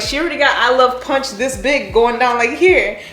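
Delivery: incredulous. Retold or imitated. Incredulous retold aside to herself.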